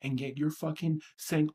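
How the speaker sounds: ordinary talk, nothing marked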